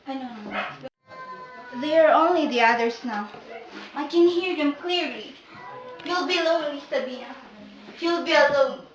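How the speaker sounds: as if hearing ghosts; eerie hush. Acted. Sabina hears the others speaking, soft eerie murmur.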